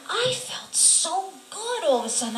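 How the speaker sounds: high-pitched wondering delight; amazed relief. Real, not acted. Woman marvels at sudden relief, amazed.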